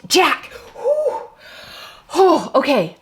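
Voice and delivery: real startled relief; relieved exclaim. Real, not acted. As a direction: yelp at the card then exhale. Relief at flipped card.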